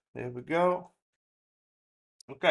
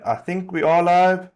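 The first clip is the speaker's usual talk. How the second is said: louder and higher, calling out to viewers; loud announcement; 5 semitones higher, 11 dB louder than usual. Real, not acted. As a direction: call out to the chat that you're on air. Checking whether the stream is live.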